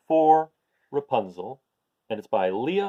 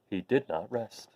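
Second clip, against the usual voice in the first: ordinary delivery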